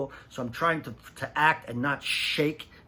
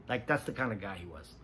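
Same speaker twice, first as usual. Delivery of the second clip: normal talking voice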